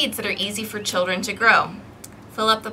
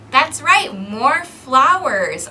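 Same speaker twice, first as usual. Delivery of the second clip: bright, louder, more flowers drawn out; cheerful praise; 2 semitones higher, 7 dB louder, much slower than usual. Real, not acted. Teacher praising the children's shouted answers, excited.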